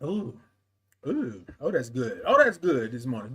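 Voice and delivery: ordinary delivery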